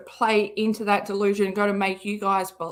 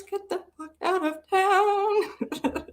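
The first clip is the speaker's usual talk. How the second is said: mock disbelief; 10 semitones higher, slower than usual. Mock disbelief at viewer's city.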